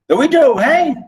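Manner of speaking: high, loud incredulous echo; indignant, disbelieving query; indignant father. Acted. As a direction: throw it back high as a challenge. Father challenges son about the widow.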